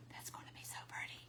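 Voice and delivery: hushed admiring whisper. Real, not acted. Crafter admiring her painted edges to viewers, whispered. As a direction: breathe the words over the finished edge.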